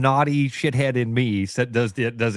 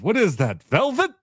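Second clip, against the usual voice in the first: accented movie impression, velvet leaps very high; Eddie Murphy character's accent; 8 semitones higher than usual. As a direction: imitate the barber's accent, squeal up on velvet. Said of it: "That was a good accent".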